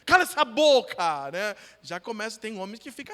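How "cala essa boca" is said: barked order, louder; mock road-rage yell at the wife; angry shout. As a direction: snap it like a furious husband in traffic. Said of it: "Já grita".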